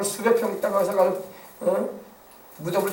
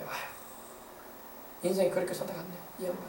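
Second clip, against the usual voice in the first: sighing onlooker; 14 dB quieter than usual. Retold or imitated. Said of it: "이런 말 들으면 안 되잖아요".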